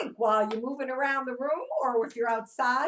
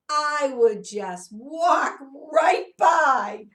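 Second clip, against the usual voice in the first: slow and drawn out; 3 semitones higher, 11 dB louder, much slower than usual. Acted. Teacher stretching out a phrase.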